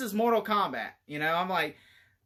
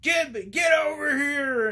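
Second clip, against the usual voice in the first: slow, high, loud growled battle cry; mock Scorpio bellow "get over here"; Scorpio shout; 8 semitones higher, 4 dB louder, slower than usual. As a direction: bellow it like the game's ninja. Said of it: "And he's like".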